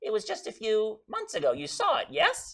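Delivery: high, put-on snooty voice; posh snob voice. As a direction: play a haughty socialite. Teasing the host about the show.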